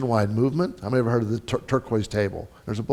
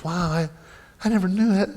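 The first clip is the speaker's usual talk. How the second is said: pleasant surprise; 7 semitones higher than usual. Acted. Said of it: "It's like the meal groups".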